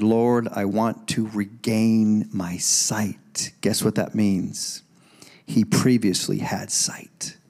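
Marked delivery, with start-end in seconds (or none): 0.0-3.5 slow, low plea; blind man answering. Acted. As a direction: ask slowly and earnestly, pitched low. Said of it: "The man answered".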